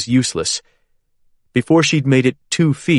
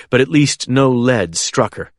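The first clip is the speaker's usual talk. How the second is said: usual speaking voice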